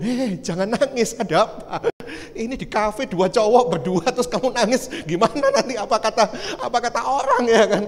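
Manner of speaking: flustered, laughing. Retold or imitated. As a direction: hush a crying friend in comic embarrassment. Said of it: "Saya bingung".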